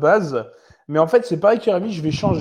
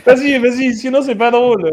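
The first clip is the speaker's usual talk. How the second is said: excited urging; 6 semitones higher, 5 dB louder than usual. Real, not acted. Urging higher bids.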